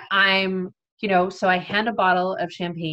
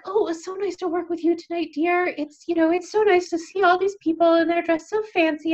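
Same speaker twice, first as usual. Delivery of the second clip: high, faster, genteel gushing; a dainty lady calling you dear; sweet volunteer; 8 semitones higher, 3 dB louder than usual. Retold or imitated. Sweet volunteer saying goodbye.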